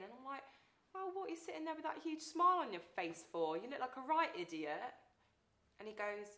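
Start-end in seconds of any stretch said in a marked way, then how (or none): 1.0-4.9 exasperated demand. Retold or imitated. Teasing demand to grinning boyfriend.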